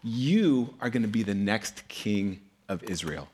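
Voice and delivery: slow, measured pronouncement with weighty pauses; solemn prophet. Retold or imitated. Prophet Samuel naming the young shepherd king, solemn. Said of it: "And he's like".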